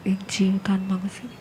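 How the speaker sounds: a quick whisper to herself; whispered aside. Real, not acted. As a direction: drop to a whisper, an aside not meant for the class. Aside, bug in her ear. (ધીમા અવાજે ફફડાટ)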